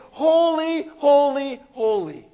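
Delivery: high ringing shout of holy; mock angel scream. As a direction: cry out holy like angels across the temple. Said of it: "they're screaming at each other".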